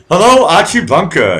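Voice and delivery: loud, high hello; loud greeting. Real, not acted. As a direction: call the name out big. Greeting a viewer loudly.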